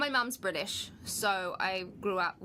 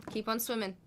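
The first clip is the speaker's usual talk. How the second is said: ordinary talk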